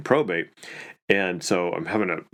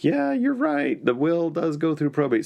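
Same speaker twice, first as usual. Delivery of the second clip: mock concession; 8 semitones higher than usual. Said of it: "and I'm like".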